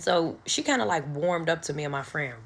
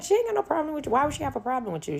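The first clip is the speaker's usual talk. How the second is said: much higher, then rushing fast; mock innocent denial; 11 semitones higher, much faster than usual. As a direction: play dumb, overly high and quick. Narrator covering for her mama.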